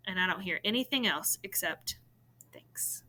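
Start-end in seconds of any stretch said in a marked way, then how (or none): 2.5-3.0 soft unvoiced whisper; a hushed whisper from bed; whispered thanks. A narrator's reading. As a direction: breathe the word out in the dark. Brother whispers from bed at night.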